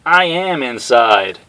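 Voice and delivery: slow, loud, high deadpan; deadpan big man. Acted. Honda telling Cammy he's inside, slow deadpan. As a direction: state the obvious slowly.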